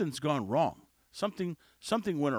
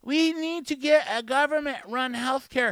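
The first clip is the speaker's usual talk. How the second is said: higher, louder mocking voice of government-healthcare fans; mocking critics; 7 semitones higher, 6 dB louder than usual. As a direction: parody the people he disagrees with. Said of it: "when people say things like".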